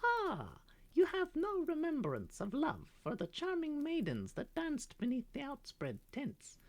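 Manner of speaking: high, longing little bird voice; a caged songbird pining for home; wistful canary. Acted. Canary reminding the parrot of their home, high and longing.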